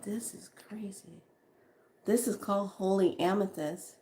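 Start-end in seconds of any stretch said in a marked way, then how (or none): 0.0-1.2 breathless awed whisper; hushed, amazed aside. Real, not acted. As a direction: whisper it to yourself in awe. Overwhelmed while reading cards.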